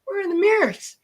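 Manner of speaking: a high, baffled demand; the officer squeaks up in shock; startled officer. Retold or imitated. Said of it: "he's like".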